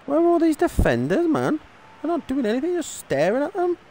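high, frustrated complaint; outraged, voice way up; exasperated. Real, not acted. Frustrated with own defenders.

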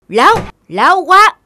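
loud, high indignant cry; loud furious stammer; angry outburst. Acted. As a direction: explode at the lying thief. Magistrate angered at the liar.

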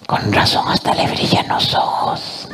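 soft conspiratorial whisper; a hushed sneaky aside. Acted. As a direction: lean in and whisper it.

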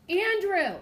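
high, loud yell of a name. Retold or imitated. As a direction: bellow it across the house, fed up. Mom finally yelling name.

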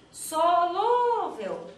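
drawn-out SOLÚVEL, soaring on LÚ; high stretched gate call; calling out word. Shown as a demonstration. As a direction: holler SOLÚVEL long and loud, stressing the middle syllable. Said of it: "Olha eu chamando a palavrinha no portão".

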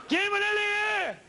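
high slow falsetto ask; teasing falsetto. Acted. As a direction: coax them in a squeaky high voice. Falsetto question to audience.

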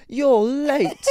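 a loud, high teasing "You're late"; spring it on her like a prank; mock cheeky greeting. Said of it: "pulled the door open and went".

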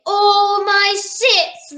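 slow, loud, high accusing roar; lightly hammed-up roaring admiral; admiral's roar. A narrator's reading. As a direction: roar each word out slowly. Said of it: "roared the admiral".

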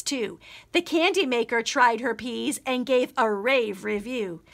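plain reading voice

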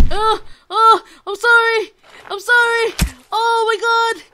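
high, loud frantic sorry; panicked apology. Real, not acted. Gamer panicking after hitting something in game, frantic high yelps.

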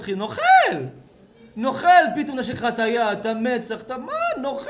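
high, loud playful accusation; mock outrage. Father calling out flattering child, mock outrage. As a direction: cry out as if exposing a little con artist.

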